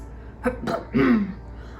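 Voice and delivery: breathy rough throat clearing. A narrator's reading. Mister Poe preparing to announce, a rough throat clearing. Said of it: "after a brief eruption of coughs".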